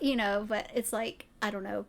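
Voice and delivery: usual speaking voice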